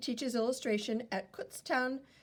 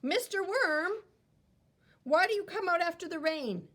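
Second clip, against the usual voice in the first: put-on high, eager child asking the worm; child questioning Mister Worm, high and eager; curious child voice; 8 semitones higher, 4 dB louder than usual. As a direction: pitch up like a curious kid.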